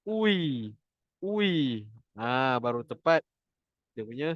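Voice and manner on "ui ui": ui with lips fully rounded forward; now the right, rounded ui; correct rounded ui. Shown as a demonstration. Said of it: "Pastikan mulut tu betul-betul muncung".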